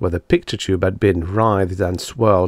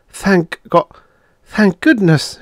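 high, louder burst of relief; relieved; 11 semitones higher, 6 dB louder, slower than usual. A narrator's reading. Woman finds the set still working, deeply relieved. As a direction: give thanks with urgent relief. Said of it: "she thought with relief".

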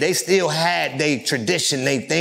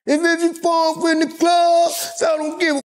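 imitating [name]'s crunk hook, high and shouted; [name] impression. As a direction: belt it like a crunk hype man in the club. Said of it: "[name] is still popping".

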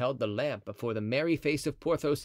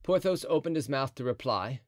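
usual speaking voice

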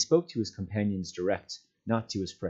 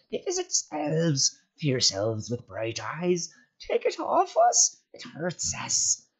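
high, strained creature voice, slow and whining; wailing Gollum; 5 semitones higher, 3 dB louder, slower than usual. A narrator's reading. Gollum begging the hobbits to take the rope off. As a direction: whine and spit like a hurt creature.

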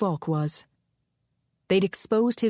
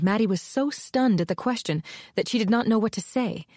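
usual speaking voice

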